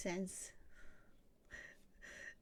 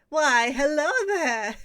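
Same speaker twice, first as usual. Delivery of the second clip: very loud, drawn-out, higher-pitched hello; hammed-up greeting; 5 semitones higher, 13 dB louder, slower than usual. Greeting a friend who just turned up, loud and hammed-up. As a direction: belt out a slow, theatrical welcome.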